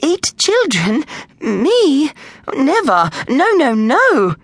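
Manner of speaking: high-pitched, aghast librarian; horrified. A narrator's reading. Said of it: "Miss Wolf looked horrified".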